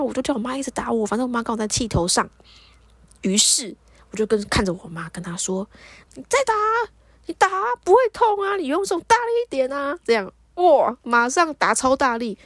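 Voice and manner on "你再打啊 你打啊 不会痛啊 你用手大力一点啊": high-pitched, goading taunt; acted-out provocation; defiant dare. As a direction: egg her on with voice pitched way up. Daring angry mother to hit harder.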